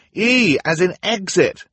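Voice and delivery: high, loud, slowed shout, E stressed. Acted. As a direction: spell it out loudly over the crash. Klaus shouts over the din.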